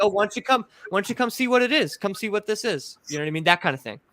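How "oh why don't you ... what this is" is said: higher, coaxing creature voice, lightly done; luring Pukwudgie. Retold or imitated. Investigator voicing a Pukwudgie luring him into the swamp, lightly higher coaxing. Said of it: "sounds like a Pukwudgie luring me".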